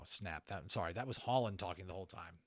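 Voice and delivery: plain reading voice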